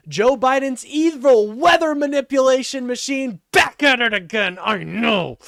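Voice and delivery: high, loud hammed-up conservative whine; mocking a conservative caller, loud and high; mock average conservative. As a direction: parody a conservative ranting about Biden. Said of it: "That's your average conservative".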